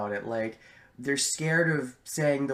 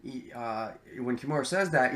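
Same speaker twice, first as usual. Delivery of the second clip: ordinary talk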